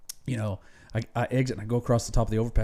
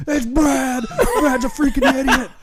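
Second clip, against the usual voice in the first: much higher, louder, laughing mock panic; Billy pleading into the phone, high and laughing; imitating Billy; 13 semitones higher, 6 dB louder than usual. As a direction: squeal the excuses while cracking up. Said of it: "And Billy's like".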